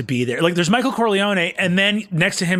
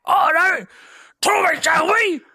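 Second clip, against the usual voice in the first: much higher, shouted mumbling tough guy; Tom Hardy impression; 12 semitones higher than usual. Mocking Tom Hardy squaring up to Tommy Shelby, high shout. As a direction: bark it like a mush-mouthed brawler. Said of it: "Tom Hardy coming up and just being like".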